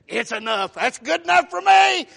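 loud, soaring declaration; full-voiced pulpit jubilation; exultant cry. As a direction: cry it out, climbing high. Preacher acts out Jacob's joyful relief.

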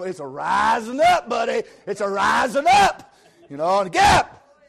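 loud, high, slow preaching shout; shouting preacher. Retold or imitated. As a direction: cry it out with fervor. Preacher shouting sergeant's words.

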